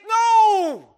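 a sudden high, loud "No!"; emphatic shout. Real, not acted. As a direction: cut the idea off with a loud cry. Preacher rejects the idea forcefully.

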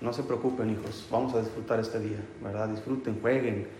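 put-on softly reassuring the kids; gentle father telling his children to relax; reassuring dad. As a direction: soothe them, all is well today. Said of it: "a que les relajo".

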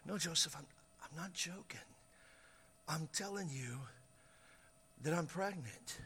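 very soft, halting plea; hushed, earnest confession; quiet insistence. Acted. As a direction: insist gently, with pauses between phrases. Mary quietly insists she is pregnant.

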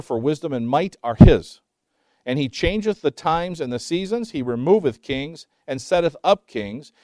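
scripture reading. Acted. Reads scripture aloud.

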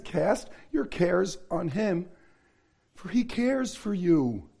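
soft, slow reading of the verse; quiet, unhurried quote of the verse; gentle scripture. Shown as a demonstration. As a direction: lay the words down gently. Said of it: "If I was God, that verse would say".

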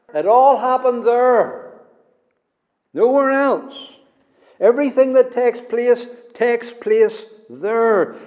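high, insistent preaching on "there"; fervent preaching. Acted. Preacher presses the congregation, fervent and high.

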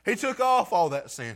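normal talking voice

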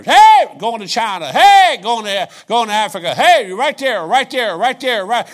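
roaring, high-pitched pulpit cry; fervent preaching. Acted. Preacher shouting a church mission call at his congregation, fervent and roaring. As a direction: throw every word out like a revival call. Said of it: "That's the fervency of this pulpit".

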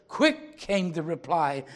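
dramatic poem reading, building in force; poem recitation. A narrator's reading. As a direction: read the poem like a storyteller before the church. Pastor recites poem aloud.

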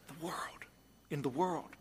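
hushed whispered aside. Real, not acted. Preacher whispering to the congregation, hushed. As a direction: drop to a bare breath of a whisper.

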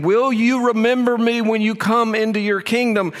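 high-pitched, earnest request; acted plea. Criminal asking Jesus to remember him, high pleading voice. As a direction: lift the whole question high, as if pleading.

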